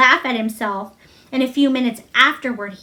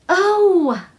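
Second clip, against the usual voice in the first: high, bright exclamation of joy; cry of delight; 7 semitones higher than usual. Acted. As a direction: burst out delighted. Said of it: "Colin cried in delight".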